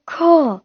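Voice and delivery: ख with nukta, drawn out slowly; nukta sound. Shown as a demonstration. Teacher showing how a dotted letter is read, slow ख with nukta. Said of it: "इस तरीके से पढ़ते हैं".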